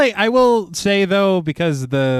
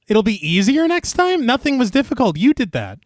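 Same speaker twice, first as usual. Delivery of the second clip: higher-pitched, indignant quote-back; exasperated objection to a character's claim; incredulous protest; 6 semitones higher than usual. Real, not acted. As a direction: throw it back in disbelief. Throws the quote back in disbelief.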